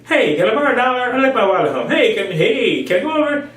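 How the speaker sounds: high, fast wheedling voice; high, pushy borrower voice; mock manipulator. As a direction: pitch it up and wheedle quickly. Voicing a manipulator.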